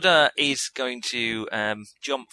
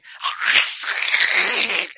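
hiss; 6 semitones higher, 8 dB louder than usual. Acted. Vampire hisses before leaping.

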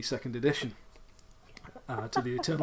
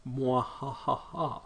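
slow sneering evil mwahaha; Hammer horror villain laugh; much slower than usual. Acted. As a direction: gloat like a panto villain. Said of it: "more seventies Hammer than eighties cheese".

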